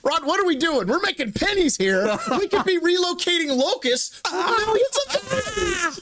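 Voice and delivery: very high-pitched comic outburst, breaking into laughter; mock-indignant high squeal; mock exasperation. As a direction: squeal it as a hammed-up complaint. Acted outburst about relocating locusts.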